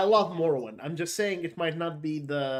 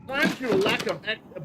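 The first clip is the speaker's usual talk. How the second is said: Darth Vader impression; 6 semitones higher, 5 dB louder than usual. Vader quote retry, louder.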